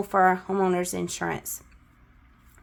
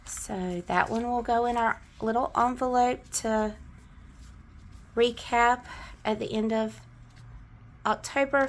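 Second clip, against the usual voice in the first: normal talking voice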